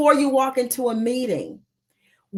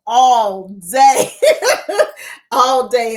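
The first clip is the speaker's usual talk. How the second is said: laughing; 8 dB louder, much slower than usual. Real, not acted. Laughing at her own enthusiasm.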